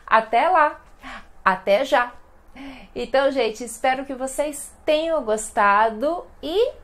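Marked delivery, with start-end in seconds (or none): 0.1-2.1 cheerful goodbyes. Shown as a demonstration. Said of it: "você pode simplesmente responder".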